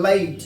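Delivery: लाइट as "leh-eet", with a glide; correct late. Shown as a demonstration. Teacher reading L-A-T for the class, लाइट with a gliding vowel. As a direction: draw the vowel of लाइट out towards "ee". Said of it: "सही तरीके से बोलते हैं".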